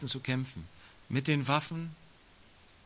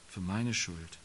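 ordinary delivery

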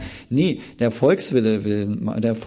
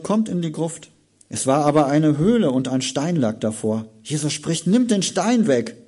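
raised, louder Bible reading; dramatic scripture reading; 4 semitones higher, 5 dB louder than usual. Acted. Preacher reading John to the congregation, dramatic. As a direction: read the story with rising drama.

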